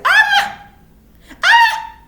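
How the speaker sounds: high, loud mocking 'Ah!'; mocking cry. Spirit taunting a person who dodges, high mocking cry. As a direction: yelp it out in mock triumph.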